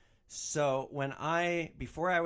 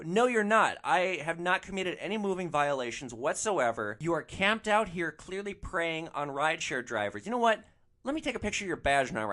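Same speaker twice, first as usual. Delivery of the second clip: higher, forceful retold argument; indignant confrontation; 5 semitones higher, 4 dB louder than usual. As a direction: push back indignantly at an official. Retold argument with the meter maid.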